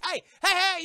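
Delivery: loud, high "hey, hey, hey"; an alarmed shout across the dock; shouting guard. Acted. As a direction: stop him from boarding. Guard catching Jack on the boat.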